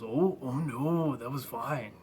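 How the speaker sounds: lightly voiced Macho Man; Randy Savage voice. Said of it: "And Randy Savage goes".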